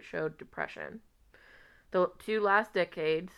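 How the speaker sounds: letter read aloud, lightly voiced; reading a love letter. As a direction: read it like a heartfelt old letter.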